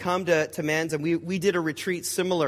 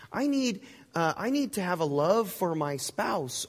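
higher, hesitant, earnest appeal; pleading prayer; 3 semitones higher than usual. Acted. Believer asking God to fix job and marriage, pleading. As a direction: plead upward in halting, needy bursts. Acted example prayers of need.

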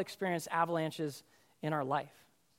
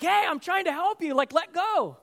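high, loud retold protest; urgent shout; 9 semitones higher, 5 dB louder than usual. Rescuer to the man yanking him down, urgent shout. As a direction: shout over someone's panic.

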